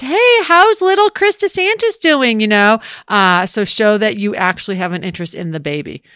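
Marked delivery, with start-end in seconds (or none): 0.0-2.4 bright, higher friendly check-in; cheerful greeting. Shown as a demonstration. Colleague greeting a new mother cheerfully about her baby. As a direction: ask after the baby with cheerful interest. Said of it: "so you can show".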